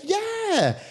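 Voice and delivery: very high put-on American yeah; fake American accent. Bluffing to a blind date, high fake American accent. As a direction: pitch it way up in a mock American accent. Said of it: "did you pretend that you were American".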